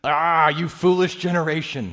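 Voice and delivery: loud, exasperated scolding; frustrated rebuke. Acted. Jesus scolding the people asking ignorant questions, loud. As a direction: throw up your hands at them. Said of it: "he says".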